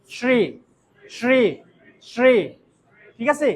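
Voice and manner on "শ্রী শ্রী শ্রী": শ্রী with a sh, "shree", tongue touching the palate; palatal sh. Shown as a demonstration. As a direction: repeat শ্রী slowly apart, each starting sh. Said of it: "শ্রী উচ্চারণটা করতে পারলে". (শ্রী-তে তালব্য শ, জিভ তালুতে)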